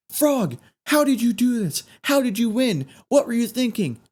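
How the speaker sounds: high, eager reporter questions. Retold or imitated. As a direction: pepper him with questions, keyed up. Reporters question the winning frog.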